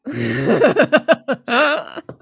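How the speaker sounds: high, loud laugh; real burst of laughter. Real, not acted. Laughing at co-host's terrible Essex accent remark, loud high laughter. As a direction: crack up with a big high laugh.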